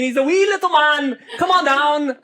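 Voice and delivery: laughing through a mock Irish lilt; giggly Irish voice calling down the wee man; Irish accent, laughing. Teacher repeats the Irish bit while laughing at herself. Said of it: "an Irish accent comes out of my mouth".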